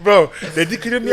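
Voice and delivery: laughing hard, voice jumps high; cracking up mid-sentence. Real, not acted. Laughing while telling hit.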